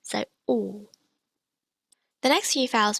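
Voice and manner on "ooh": ooh rounded, mid-mouth; pinyin u. Shown as a demonstration.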